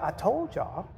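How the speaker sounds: low, soft voice of God speaking down; low quiet voice as God telling his children; voicing God. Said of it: "he's like".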